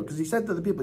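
usual speaking voice

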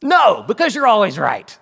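loud, high exclamation; emphatic mock answer. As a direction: belt out the answer as an obvious truth. Playful answer to own question.

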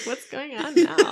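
mock puzzled. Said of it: "They're like".

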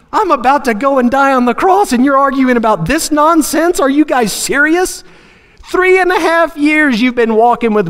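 high-pitched exasperated rant; flying off the handle. Acted. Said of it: "I would have flown off the handle".

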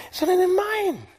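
high, loud defiant outcry; defiant shriek. Retold or imitated. Rebellious believer insisting on own will, high defiant outcry. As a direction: squeal it like a stubborn child insisting. Voicing rebellious human to God.

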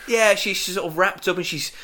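usual speaking voice

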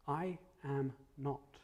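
slow, low, each word set apart in "I am not"; weight every word of "I am not", drawn out slowly; emphatic denial. Acted. Peter denying he knows Jesus, emphatic and slow. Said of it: "And emphatically, Peter says".

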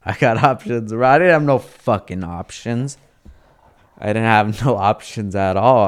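amused, self-mocking, laugh breaking in; real chuckle through the words; laughing admission. Real, not acted. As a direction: laugh at your own empty boast. Laughs admitting the boast was false.

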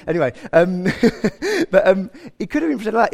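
real laugh breaking into the words. Real, not acted. Preacher amused by his own aside, laughing.

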